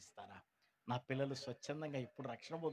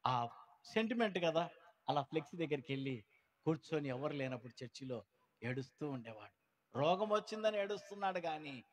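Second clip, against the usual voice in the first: ordinary talk, nothing marked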